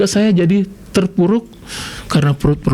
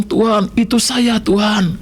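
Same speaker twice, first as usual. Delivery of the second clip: retold tearful prayer; 5 semitones higher than usual. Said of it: "saya menangis".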